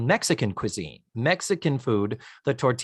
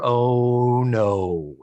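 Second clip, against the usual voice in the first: ordinary delivery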